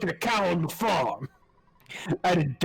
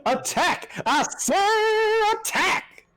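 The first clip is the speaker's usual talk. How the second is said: high-strung evangelist; much slower than usual. Retold or imitated. Imitating Neverwinter Nights evangelist.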